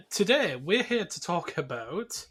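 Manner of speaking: usual speaking voice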